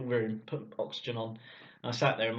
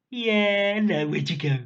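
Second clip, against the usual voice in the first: nurse voice; 7 semitones higher, 8 dB louder, slower than usual. Retold or imitated. Nurses after he revives.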